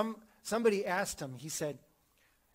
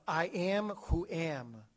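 slow solemn declaration; much slower than usual. Acted. God's name declared.

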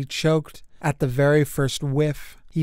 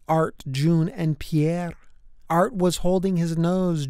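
ordinary delivery, nothing marked

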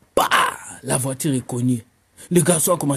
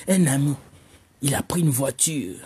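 ordinary talk

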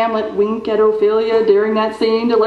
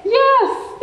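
yes leaping much higher, delighted; gleeful yes; 12 semitones higher than usual. Acted. Director thrilled by the saying, gleeful. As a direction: pounce on it with glee. Delighted about breaking legs.

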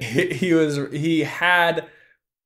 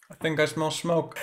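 soft, understated threat; morbidly amused menace; 8 dB quieter than usual. Retold or imitated. Kaz threatening the rival leader, quietly morbid. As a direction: drop it quietly, dryly ominous. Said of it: "It's a funny kind of tormented".

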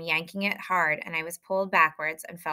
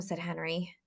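usual speaking voice

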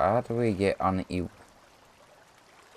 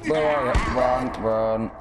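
yelling; 5 semitones higher, 5 dB louder than usual. Real, not acted. Chasing fleeing enemy.

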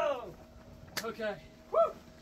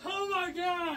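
shocked exclamation; 4 semitones higher than usual. Real, not acted. Plunge into icy water.

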